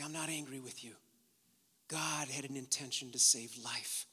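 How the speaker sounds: very soft, low, with pauses; hushed tenderness. Acted. God reassuring those who feared him, hushed and tender. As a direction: speak forgiveness gently, sinking to a whisper. God's tender words, sermon close.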